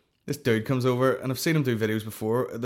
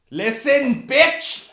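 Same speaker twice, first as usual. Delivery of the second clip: high, loud, hammed-up put-down; mock sassy retort; 7 dB louder, much slower than usual. Comic about to school a stranger on videos, mock sassy. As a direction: screech it in an exaggerated silly voice.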